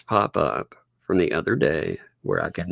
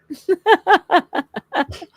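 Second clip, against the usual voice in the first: shrill burst of laughter; high squealing laugh. Real, not acted. Laughing at the accent.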